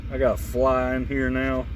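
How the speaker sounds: usual speaking voice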